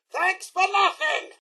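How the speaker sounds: high, sarcastic thanks for nothing; a bitter high jab at the player; indignant parting shot. Acted. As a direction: throw the thanks back bitterly. Robot blaming the player.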